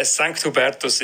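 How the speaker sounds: normal talking voice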